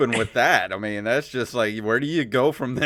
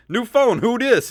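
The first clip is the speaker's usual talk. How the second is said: higher, louder joking shout; mock yell; 10 semitones higher, 5 dB louder than usual. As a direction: holler it as you bolt. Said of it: "you should have just yelled".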